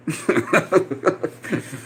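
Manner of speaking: short soft laugh burst; breathy laugh. Real, not acted. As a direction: laugh it off, exasperated. Laughing at chat.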